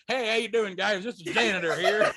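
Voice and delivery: hammed-up friendly janitor; cheery janitor voice. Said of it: "I'm like".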